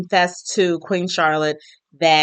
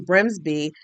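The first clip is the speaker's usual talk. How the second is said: ordinary talk, nothing marked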